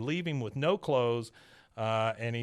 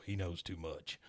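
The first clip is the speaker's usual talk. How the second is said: much lower and softer, mock menace; low sinister aside; 7 semitones lower, 11 dB quieter than usual. Stinky muttering a threat from off screen, low and soft. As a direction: drop it low like a hidden threat.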